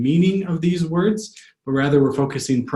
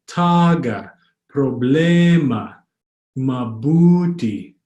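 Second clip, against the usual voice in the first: TA-ga, pro-BLE-ma, ma-BU-ti in "taga, problema, mabuti"; slow "taga, problema, mabuti", each penultimate vowel held; stress on second-to-last syllable; 4 dB louder, much slower than usual. Shown as a demonstration. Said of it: "the second to last syllable".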